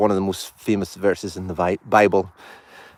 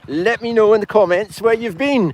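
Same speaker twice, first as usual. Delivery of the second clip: excited host; 12 semitones higher, 5 dB louder than usual. Acted. High-pitched live show greeting viewers.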